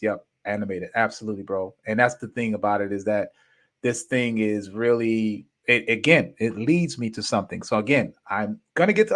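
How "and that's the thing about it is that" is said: ordinary talk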